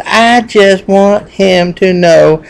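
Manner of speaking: high, loud, very slow proclamation; desperate little bull. Acted. Little bull making sure the huge bull knows, desperately emphatic. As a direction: announce it word by word, pitched right up.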